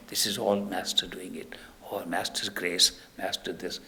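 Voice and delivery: whispered, hushed thanks; awed whisper. Retold or imitated. Disciple seeing the master's miracles, awed whisper. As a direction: breathe it out in awe of the master. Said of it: "we automatically begin to say".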